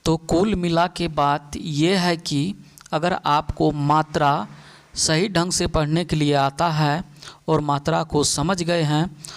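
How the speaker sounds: ordinary talk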